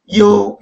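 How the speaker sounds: 이유 as a drawn-out glide from i into yu; Chinese reading. Shown as a demonstration. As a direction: model 이유 as one held Chinese syllable. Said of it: "중국어로는 그렇게 읽으면 안 됩니다".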